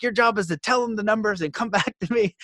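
exasperated mock scolding; upset boss. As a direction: retell the boss's irritated order with a laugh in it. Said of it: "And he's so upset".

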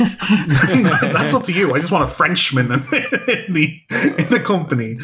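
can barely get the quote out for laughing; giggly mock boss voice; laughing through a quote.

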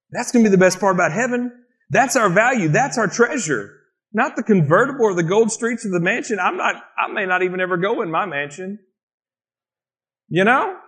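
ordinary delivery

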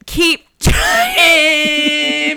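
high, loud, drawn-out holler; hammed-up call. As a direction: call it out high and stretch the last word.

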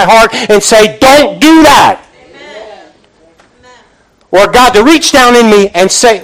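rising, fervent preacher's call; preaching at full force. Acted. Pastor stirring up his church with full-force preaching. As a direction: drive it home from the pulpit.

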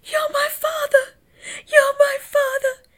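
loud, squealing imitation of wailing; exaggerated falsetto sobbing impression; high-pitched cry. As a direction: wail it way up high, drawn out, mocking. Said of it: "The high-pitched cry".

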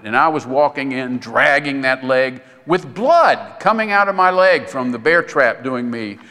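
ordinary talk, nothing marked

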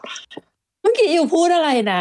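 high-pitched, slow, puzzled quote; baffled asking. Retold or imitated. City dweller to a mumbling tradie, acted bafflement. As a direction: act out not catching a word of it.

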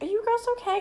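high-pitched kindly stranger; concerned store lady. Retold or imitated. Store worker to wandering girls, high concerned voice. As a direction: check gently on lost children, pitched high.

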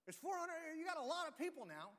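high, loud mocking coach; Elijah taunting. Elijah taunting the prophets of Baal, high and loud. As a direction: egg them on, grinning. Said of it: "this is Elijah going".

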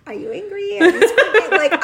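high, loud lines broken by laughter; cracking up while asking; laughing. Real, not acted. Retelling her own reaction to the client, high through laughter.

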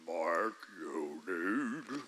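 very low, slow, eerie devil voice; devil's voice. Acted. Preacher playing the devil's voice tempting Mark, very low and slow. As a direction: drag it out deep like a demon. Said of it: "That sounds like the devil".

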